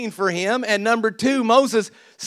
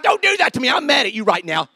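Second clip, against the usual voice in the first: high-pitched imitation of a cross wife; mock angry wife; 5 semitones higher than usual. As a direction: protest in a raised, indignant mock voice.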